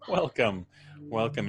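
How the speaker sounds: ordinary talk, nothing marked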